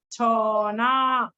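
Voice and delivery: छौना drawn out very slowly; slow stretched syllable. Shown as a demonstration. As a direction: stretch छौना to show the vowel. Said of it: "ओ का sound सुनाई दे रहा है ना छ पे". (छौना को धीरे-धीरे खींचकर)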